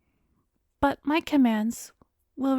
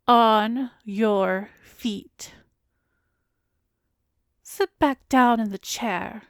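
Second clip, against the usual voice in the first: louder, slow commanding order; firm command; 6 dB louder, slower than usual. Acted. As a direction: order the thrall up and back down. Commanding entranced subject.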